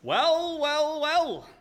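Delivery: loud, high, drawn-out gloat; gloating greeting. Acted. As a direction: savour catching him at last. Ex-droog cop gloats at Alex.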